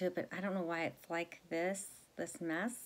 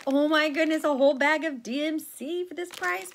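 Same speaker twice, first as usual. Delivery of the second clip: higher, much louder thrilled outburst; loud, high-pitched delighted amazement; excited disbelief; 9 semitones higher, 10 dB louder than usual. Retold or imitated. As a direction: re-enact a gleeful bargain find. Acting out her thought on finding a bargain.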